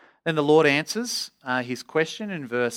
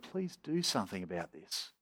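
soft, low plea; subdued cry to God; 5 semitones lower, 12 dB quieter than usual. Acted. Preacher voices the brokenhearted praying to God, quietly pleading. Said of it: "And we cry out to God".

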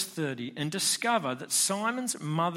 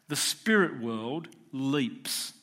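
slow dramatic; much slower than usual. Real, not acted. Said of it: "preacher slows for dramatic effect".

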